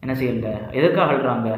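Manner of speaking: normal talking voice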